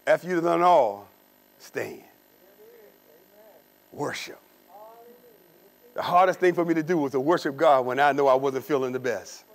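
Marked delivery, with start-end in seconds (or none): none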